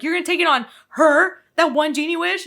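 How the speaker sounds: imitated indignant question; mock incredulous. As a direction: act out someone who can't believe the choice. Acting his disbelief at the wish.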